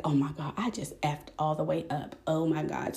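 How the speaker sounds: soft, sunken voice to yourself; quiet retold dismay. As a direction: replay the dismay under your breath. Retelling dismay at her mistake.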